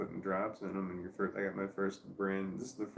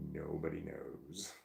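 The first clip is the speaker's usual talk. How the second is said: hushed mysterious; 5 semitones lower, 8 dB quieter, slower than usual. Acted.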